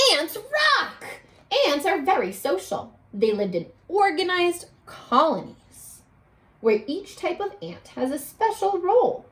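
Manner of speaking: storybook read-aloud voice; bright read-aloud for a class; expressive classroom storybook reading; reading to children. Acted. Teacher reads fact page.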